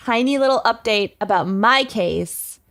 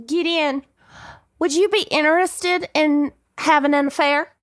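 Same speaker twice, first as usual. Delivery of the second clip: put-on higher-pitched Texan church lady accent; 5 semitones higher than usual. Candy asking Alan for an affair, Texan church lady accent. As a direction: proposition him in a sweet Texas twang. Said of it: "Texan church lady murderer accent".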